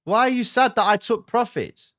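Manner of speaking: high, loud disbelieving repeat; indignant repeat. Real, not acted. Repeats viewer question, indignant.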